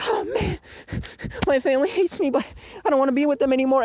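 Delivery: high-pitched self-pitying impression; mock whiny loser. Hakuri complaining about the family, mock-whiny. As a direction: whine like a hopeless underdog.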